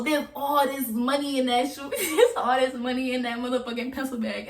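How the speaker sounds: laughing in disbelief. Real, not acted. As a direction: laugh through it, amazed. Shocked at cash in bag.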